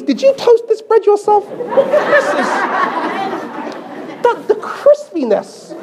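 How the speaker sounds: high, over-the-top raving praise; gushing husband. Retold or imitated. Husband lavishly praises his wife's beans on toast, high and gushing. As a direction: rave about the toast like it's a feast.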